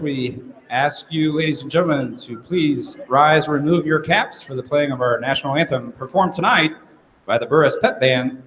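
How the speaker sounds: usual speaking voice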